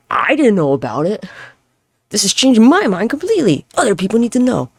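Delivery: high-pitched, eager proclamation; acted excited revelation. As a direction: urge everyone to hear the discovery. Acting out own excited reaction.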